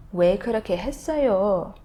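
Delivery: "왜 그렇게 했어요?" quick with a swooping ending; emotive intonation. Shown as a demonstration. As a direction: stretch "요" up and down with feeling. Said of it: "this emotion that you have".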